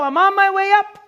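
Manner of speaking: high, loud, hyped-up boast; pumped up. Pretend. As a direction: hype it up like a rising star. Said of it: "I say to this hair".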